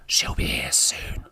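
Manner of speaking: whispered under the breath to a friend; whispered aside. Acted. Jack whispers to Charlie behind the guests' backs, urgent and hushed. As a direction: hush it so the guests can't hear.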